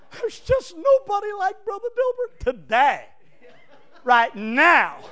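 high, laughing mock praise; mimicking members who flatter today and turn next week; sarcastic gushing. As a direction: fawn over the preacher tongue in cheek. Mocking the fickle congregation.

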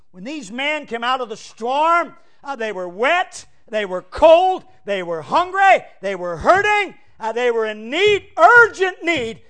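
a revival preacher climbing to a shout; building sermon cry. Acted. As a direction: preach it up to a cry, slow at the start. Preacher builds the storm list.

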